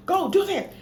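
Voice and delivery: sudden high yelled order; frantic command; panicked shout. Retold or imitated. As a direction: bark it out in a panic. Panicked in emergency.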